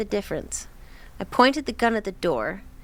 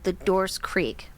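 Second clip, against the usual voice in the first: ordinary delivery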